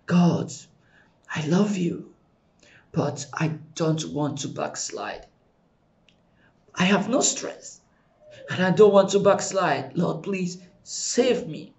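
a quiet broken plea to God; softly pleading, as if near tears; retold tearful prayer. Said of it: "I will be crying".

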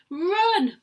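high, loud cry of alarm; shouted warning. Acted. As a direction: scream a warning to the kids. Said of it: "Mugglewump shouted to the children".

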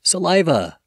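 Saliva said in full as suh-LYE-vuh; Saliva, three clear syllables suh-LYE-vuh, falling; slow clear dictionary pronunciation. Shown as a demonstration. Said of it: "break it down syllable by syllable".

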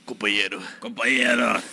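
lightly voiced Lula with lisp; Lula impression. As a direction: do Lula's slushy speech. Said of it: "a imitação do Lula dele".

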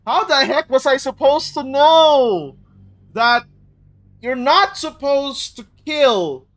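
loud, rising protest; exasperated outburst. Real, not acted. Gamer asking how they could have known, loudly exasperated. Rails at unclear game rule.

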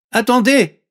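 high, loud frog voice; talking frog. Acted. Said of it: "dit la grenouille". (voix de grenouille aiguë et forte)

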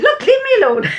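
mock exasperated, pitched well up; a retold huff, half laughing, voice jumping high; exasperated retort. Telling her husband off over the rice, mock exasperated.

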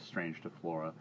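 ordinary delivery, nothing marked

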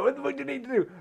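high panicked cry. Retold or imitated. Imitating the panicked couple, high frantic cry. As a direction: squeal it like they're panicking.

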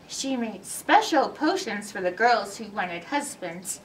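ordinary delivery

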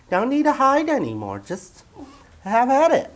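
very high, sing-song voice coaxing a pet; talking to the dog Daniel in a high cooing voice. Acted. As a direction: coo at him like a baby to coax him out.

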